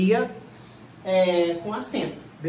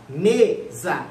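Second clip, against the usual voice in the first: mesa slowly split as ME-za; stress on first syllable; 3 semitones lower, 4 dB louder than usual. Shown as a demonstration. Teacher confirming the tonic syllable, mesa stressed on ME. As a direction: draw out the ME of mesa, then drop za. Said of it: "É o me".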